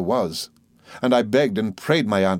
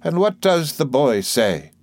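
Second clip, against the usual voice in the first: brisk aunt; 6 semitones higher, 3 dB louder, slower than usual. Acted. Aunt voiced in story.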